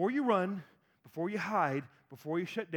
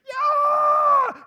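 shrieking much higher and louder; sudden shout; 13 dB louder than usual. Retold or imitated. Pastor acting the chariots bursting in, loud shout. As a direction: yell out as the chariots appear.

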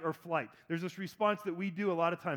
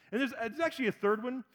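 usual speaking voice

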